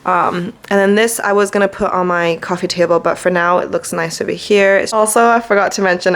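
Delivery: ordinary delivery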